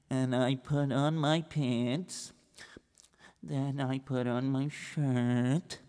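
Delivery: soft, slow, dragging account; slow plodding recital. Acted. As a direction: drag out every boring detail. Slow plodding story of Reb Israel.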